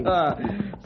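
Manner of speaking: a brief amused chuckle; short chuckle. Acted. Colonel chuckles at the offer of coffee and a chat, soft chuckle.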